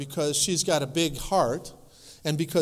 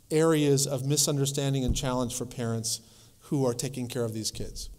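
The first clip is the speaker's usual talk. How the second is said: ordinary talk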